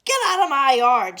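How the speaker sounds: very loud angry yell; old woman yelling a pig off her yard; furious shout. Acted. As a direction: bellow it at the pig. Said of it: "she shouted at Mercy".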